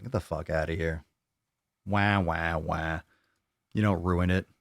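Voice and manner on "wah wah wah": a whiny fake wail; mock the complainers with a babyish wah wah wah; mock baby crying. Mocking viewers who whine about coffee with a fake baby cry.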